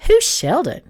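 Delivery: high, puzzled question; feigned confusion. A narrator's reading. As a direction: play innocent and baffled. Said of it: "pasted on a confused look".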